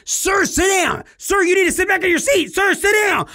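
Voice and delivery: high, loud barked order over the PA; stern stewardess command. Retold or imitated. Flight attendant ordering the standing passenger to sit, loud and high. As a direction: snap it like a flight attendant on the mic.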